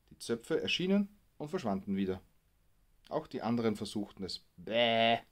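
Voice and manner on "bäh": louder mock Bäh like a sheep; sheep bleat. A boy teasing the tower, louder mock bleat. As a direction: bleat it cheekily into the microphone. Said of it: "rief der eine".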